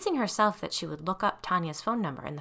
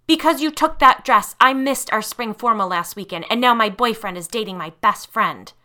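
loud, raised, bitter tirade; furious accusation; 3 semitones higher, 11 dB louder than usual. Acted. Tanya accusing Lisa of ruining her life, furious. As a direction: unload every grievance at her.

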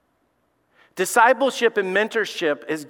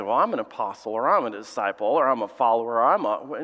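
mocking a churchgoer; 7 semitones lower than usual. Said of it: "You can sit there and say".

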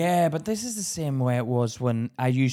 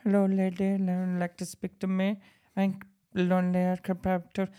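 imitating a fresh-arrived Indian man, slightly raised pitch; mock Indian newcomer accent; 3 semitones higher than usual. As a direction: put on a hesitant Indian accent. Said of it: "fresh into the country ... they'd be like".

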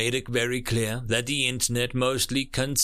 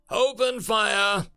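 high, drawn-out battle command; shouted military order, pitched up; yell; 9 semitones higher, 3 dB louder, slower than usual. A narrator's reading. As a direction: yell the order to your squad. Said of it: "the human yelled".

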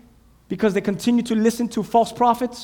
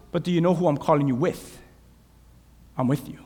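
softer, lower reassurance; reassuring; 6 semitones lower, 7 dB quieter, faster than usual. Acted. God answers frightened Jeremiah, soft and reassuring. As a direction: settle him gently.

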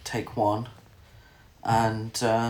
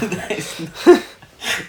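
high, loud giggling fit; loud high laugh; real laughter; 7 semitones higher, 6 dB louder than usual. Real, not acted. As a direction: laugh it out helplessly. Still laughing at own accent.

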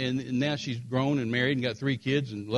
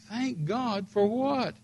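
high, drawn-out puzzled question; baffled disbelief; 8 semitones higher, much slower than usual. Retold or imitated. Wife questioning his relief, retold bafflement. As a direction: echo it slowly, pitched up in bewilderment.

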